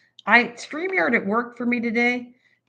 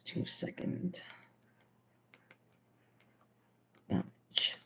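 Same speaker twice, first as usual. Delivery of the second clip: barely voiced, mouthing the words as she writes; breathy self-dictation; whispered to herself; 16 dB quieter, much slower than usual. Real, not acted. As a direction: whisper it under your breath while jotting it down. Mouthing recipe words while writing.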